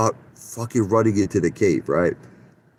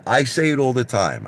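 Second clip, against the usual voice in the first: ordinary talk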